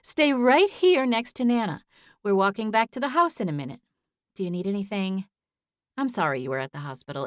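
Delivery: a caring neighbour lady; kind older woman voice. A narrator's reading. Amanda's voice held.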